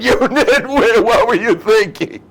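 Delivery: high, loud, laughing outburst; exploding wife. Pretend. Wife sits up in bed and blows up at husband, high and loud. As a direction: burst out at two in the morning. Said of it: "she sits up in bed".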